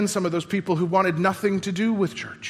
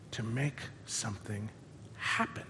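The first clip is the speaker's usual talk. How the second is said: much softer, almost a whisper; hushed; 7 semitones lower, 15 dB quieter than usual. Acted. Pastor quietly landing God's promise. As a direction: let it fade to a hush.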